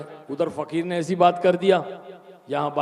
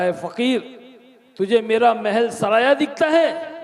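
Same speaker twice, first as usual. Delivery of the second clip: angry king; 5 semitones higher, 4 dB louder than usual. Retold or imitated. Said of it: "गुस्सा आया".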